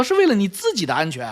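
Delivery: ordinary talk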